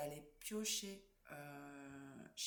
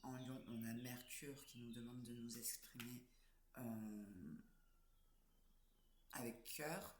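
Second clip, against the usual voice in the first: hoarse, cracking morning voice; 5 semitones lower, 3 dB quieter than usual. Real, not acted. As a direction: voice breaking from hoarseness. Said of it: "j'ai une voix, euh, cassée".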